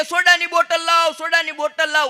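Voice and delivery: high, loud demand for a soda bottle; hammed-up panicked order; high, loud bark for soda; mock urgent yell. Old man yelling for soda.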